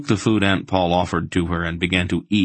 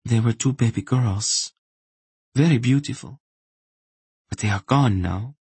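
higher, understated bereaved woman; dead voice; 6 semitones higher than usual. A narrator's reading. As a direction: recall the loss with feeling drained away. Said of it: "replied in a dead voice".

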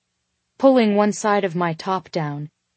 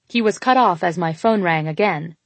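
usual speaking voice

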